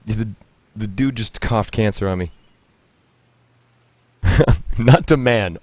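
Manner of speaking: normal talking voice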